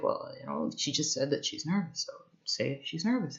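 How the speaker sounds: whispering teacher aside; whispered. Retold or imitated. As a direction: whisper the instruction. Teacher's advice retold.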